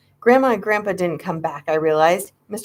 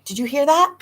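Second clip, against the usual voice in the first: excited; 8 semitones higher than usual. A narrator's reading. Excited kids hear car.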